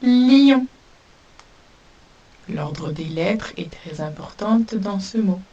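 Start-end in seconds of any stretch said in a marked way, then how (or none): 0.0-0.6 lion lancé haut et fort; mot dicté. Shown as a demonstration. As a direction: annoncer lion avec force. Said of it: "Mot numéro deux". (lion, articulé haut et fort)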